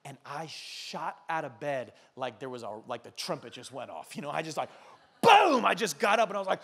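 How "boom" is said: loud high startled boom; sudden loud boom sound; mock boom. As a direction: burst out with a boom as you wake. Jolted awake by tapping.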